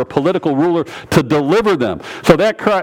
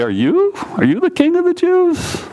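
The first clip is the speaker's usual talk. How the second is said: high-pitched disbelieving question; incredulous, pitch shooting way up; almost incredulous. Acted. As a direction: ask it in soaring disbelief. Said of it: "I would think almost incredulously".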